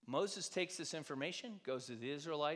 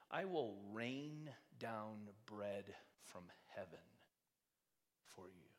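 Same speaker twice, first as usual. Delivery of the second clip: lower, softer, slow solemn voice; voice of God; 4 semitones lower, 7 dB quieter than usual. Retold or imitated. God answering Moses in the desert, low and slow. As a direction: speak slowly and gravely as God answering. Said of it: "this answer that God says to Moses".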